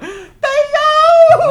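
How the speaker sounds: very high, very loud wail; Cosby. Acted. Comic joking about wearing a mask, loud wailing Cosby voice. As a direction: belt the name out in a hammed-up sitcom dad voice. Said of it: "puts on a Cosby voice".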